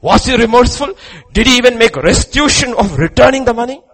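loud, high rhetorical questions; full-force preaching. Acted. Preacher questioning congregation about Judas's remorse, full-force shout.

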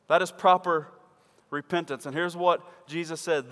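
ordinary delivery

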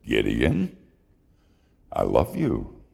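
low and gentle, like a loving father; God's voice. A narrator's reading. God answers again.

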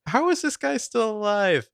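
high-pitched incredulous question; mock disbelief. Joking that Holden is still alive, high mock disbelief. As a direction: push it up high in mock amazement.

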